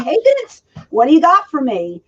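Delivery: high, excited hey Vince; bright retold greeting to the boss; eager shouted greeting. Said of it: "I ran like a lunatic".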